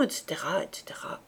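fading off into a whisper; trailing whisper. Acted. General's pompous titles dwindling to a whisper. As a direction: let the list die away unvoiced.

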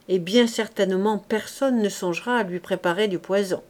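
ordinary delivery, nothing marked

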